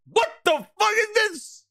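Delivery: high-pitched mock outrage; outraged Herman. Herman finding the antics in the studio, high outraged yelp. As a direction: act out an appalled boss's disbelief.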